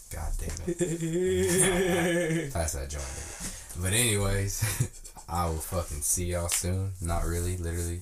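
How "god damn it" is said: drawn-out exclamation. Real, not acted.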